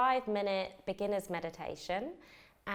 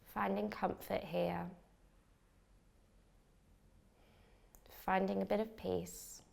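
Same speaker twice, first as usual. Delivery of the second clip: calm, unhurried meditation guide with long pauses; measured meditation voice, leaving space for each breath; guided meditation. Acted. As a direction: lead a beginner gently into relaxation, phrase by phrase. Leads a beginner's meditation.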